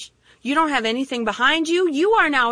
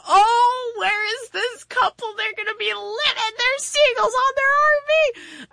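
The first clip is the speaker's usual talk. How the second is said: gleeful alarm; 10 semitones higher than usual. Retold or imitated. Preacher spotting seagulls back on the RV, high gleeful exclaim. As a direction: announce the seagull disaster with glee. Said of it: "And I'm like".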